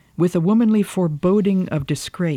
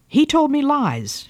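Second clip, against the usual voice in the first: much higher, louder and slowed, a fierce cry; 11 semitones higher, 4 dB louder than usual. A narrator's reading. Catherine cries out fiercely against her seducer. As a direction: hurl the accusation upward. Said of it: "she cried fiercely".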